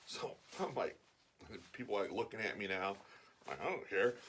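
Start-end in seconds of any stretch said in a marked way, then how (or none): none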